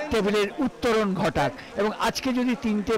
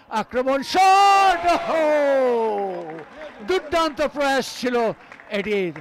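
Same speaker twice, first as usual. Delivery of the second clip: sudden high, loud shout of the shot; frantic football commentary; 5 semitones higher, 5 dB louder, much slower than usual. Real, not acted. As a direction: shout the shot, gasp at the miss. Shot, near miss.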